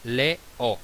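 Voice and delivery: "le, o" split into two separate vowels; syllable by syllable. Shown as a demonstration. Teacher showing a hiatus, "le, o" split in two syllables. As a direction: break "le, o" cleanly between the syllables. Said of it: "pertenecen a sílabas distintas".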